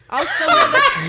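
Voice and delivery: high, loud, laughing outburst; laughing hard. Real, not acted. Guest joking to hosts about losing her job, laughing loudly. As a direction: burst out laughing through the words.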